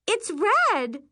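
put-on high, loud girlish shock; shocked exclamation. A narrator's reading. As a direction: blurt it out, appalled. Bunkmates exclaim over rash.

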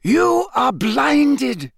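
slow, high, forceful rebuke; impassioned, drawn-out accusation; voice rising. A narrator's reading. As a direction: pronounce it with blazing conviction. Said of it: "his voice rising now".

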